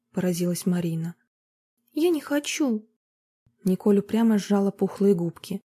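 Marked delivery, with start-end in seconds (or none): 1.9-2.9 put-on high, pouting girl's voice; a small girl digging her heels in; girl refuses music lessons stubbornly; stubborn little girl.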